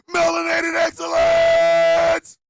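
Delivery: shouted high, cracking with mock emotion; hammed-up ecstatic proclamation; triumphant yell. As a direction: scream it like a preacher at the peak. Over-the-top ad boast.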